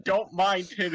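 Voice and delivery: usual speaking voice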